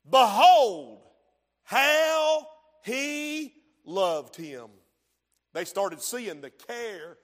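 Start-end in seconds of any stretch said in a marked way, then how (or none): 0.1-4.7 slow, drawn out Behold; awed reading. Acted. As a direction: marvel at his love.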